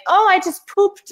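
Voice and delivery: put-on high, childish little voice; mock baby voice. Mother voicing her toddler, in a high childish voice. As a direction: squeak it out like a small kid.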